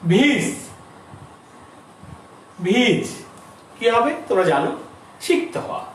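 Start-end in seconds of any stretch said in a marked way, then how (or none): none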